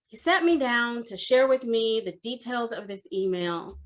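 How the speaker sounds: usual speaking voice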